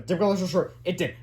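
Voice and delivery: usual speaking voice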